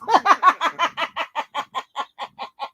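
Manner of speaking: long, helpless laughter dying away; real laughing fit. Real, not acted. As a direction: burst out laughing and keep going. Cracks up at mama's threat.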